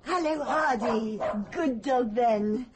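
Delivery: loud, slow, doting hello to the dog; fond greeting to dog. Acted. Mother greeting the dog Hardy, doting and loud. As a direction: coo over the family dog.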